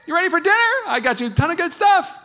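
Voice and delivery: wife calling out brightly at dinner time; bubbly wife. Retold or imitated. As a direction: breeze in cheerfully calling him. Wife arriving cheerful.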